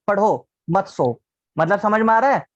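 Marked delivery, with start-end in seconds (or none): 0.1-1.2 पढ़ो set apart, then मत सो; pause after पढ़ो. Shown as a demonstration. Teacher showing where the stop falls, pause after पढ़ो. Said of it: "तुमने कहां पर स्ट्रेस दे दिया".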